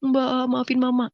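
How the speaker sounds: high, drawn-out tearful plea; crying sibling. Retold or imitated. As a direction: imitate a weeping child begging forgiveness. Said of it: "Adik saya yang menangis".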